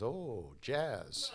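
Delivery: swooping, drawn-out oh; exaggerated delighted realization; mock impressed sadhu. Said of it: "the other guy goes".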